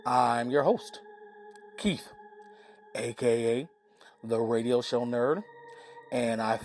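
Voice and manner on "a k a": usual speaking voice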